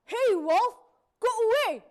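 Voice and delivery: loud commanding shout; hunter shouting. A narrator's reading. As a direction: drive the wolf off. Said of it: "said the hunter".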